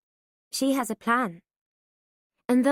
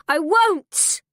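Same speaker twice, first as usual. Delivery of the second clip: loud, high shout; furious shout; 5 semitones higher, 5 dB louder than usual. A narrator's reading. Daughter shouting at her mother, furious. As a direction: scream your refusal. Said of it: "I shout".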